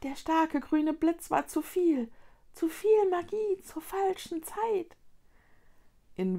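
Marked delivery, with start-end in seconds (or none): 0.0-5.0 high, little creature voice; small Luni creature. A narrator's reading. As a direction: explain gravely in a tiny high voice. The Luni goes on.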